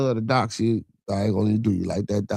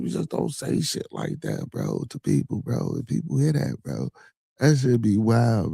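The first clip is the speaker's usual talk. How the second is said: ordinary talk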